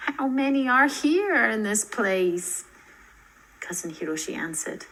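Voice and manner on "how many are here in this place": lightly voiced girl. Narrator voices Lizzie asking.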